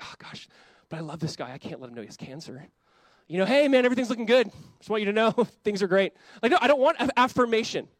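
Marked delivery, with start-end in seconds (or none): 0.0-2.7 hushed, softer private worry; doctor fretting quietly. Retold or imitated. Doctor hiding bad news, fretting under his breath. 3.3-6.1 higher, louder breezy false cheer; fake cheerful doctor. Doctor hiding cancer from patient, hammed-up breezy cheer.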